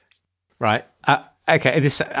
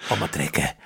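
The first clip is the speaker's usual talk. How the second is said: low, soft South African "I'm a trekker"; mock South African Keith; 8 semitones lower, 4 dB quieter than usual. Echoing the Keith line again in a low South African voice.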